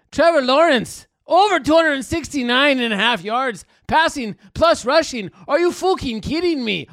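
loud, high, hyped-up yelling; excited shout. Acted. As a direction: shout it like a thrilled punter at the pub. Persona shouting excited bet.

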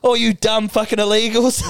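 retold cocky jeer; mock gloating. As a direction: crow it like a smug winner. Gloating after passing customs.

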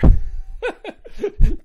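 loud, high laugh; sudden big laugh; burst of laughter. Real, not acted. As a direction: crack up at your own joke. Laughs at own retold line.

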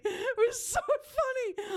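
high, slow, wailing through laughter; laugh-crying. Acted. Host retelling meeting a fan, wailing with laughter. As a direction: drag it out, half crying with laughter.

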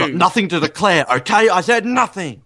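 high, agitated tough-spy impression; Daniel Craig. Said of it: "What would Craig be?".

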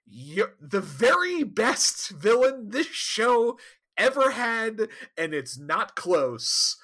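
big theatrical reveal, easing off at the end; grand announcer introduction. Pretend. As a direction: introduce a star villain with showy pauses. Grandly introducing the returning villain.

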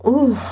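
sharp, high ooh of surprise; cried out. Acted. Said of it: "I cried out".

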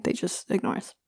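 under the breath, almost voiceless; muttered aside. Real, not acted. Sudden drop.

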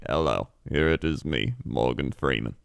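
very low, measured narrator impression; Morgan Freeman. Showing friend the intonation-only impression, deep and measured. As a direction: drop deep for a loose celebrity impression. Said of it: "I would do my Morgan Freeman".

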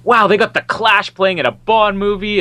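mock excited viewer. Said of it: "as if people are gonna be like".

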